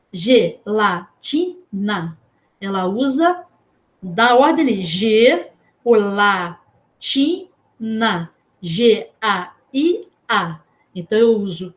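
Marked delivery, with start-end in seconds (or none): 0.1-2.2 "Gelatina" very slow, four isolated syllables. Shown as a demonstration. Teacher matching letters to syllables, "Gelatina" syllable by syllable. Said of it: "Conta no dedo". 8.6-10.6 "G A I A" slowly, each letter named separately. Shown as a demonstration. Teacher showing one letter per syllable, "G A I A" letter by letter. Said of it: "uma letra pra corresponder a uma sílaba".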